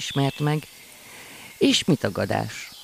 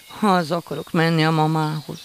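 tearful cry; 5 semitones higher, 5 dB louder than usual. A narrator's reading. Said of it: "kiáltoztak könnyes szemekkel".

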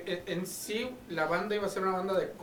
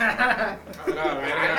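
talking through laughter; laughing; 5 dB louder, much slower than usual. Real, not acted. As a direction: laugh the words out. Laughing banter.

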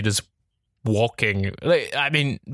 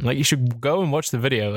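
usual speaking voice

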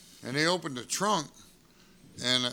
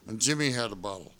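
ordinary talk, nothing marked

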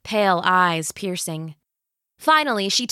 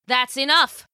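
angry snap; 6 semitones higher than usual. Acted. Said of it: "Scarlet snapped".

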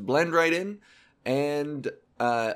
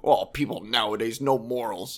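mock moralizing grumble; 5 semitones lower than usual. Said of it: "I see that all the time too".

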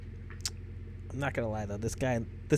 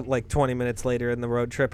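usual speaking voice

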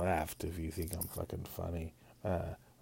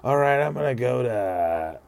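higher, louder, rising to a shout; impulsive runaway; 4 semitones higher, 6 dB louder than usual. Retold or imitated. Runner after a breakup, storming off loudly. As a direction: blurt it out, ready to flee. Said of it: "you just, like".

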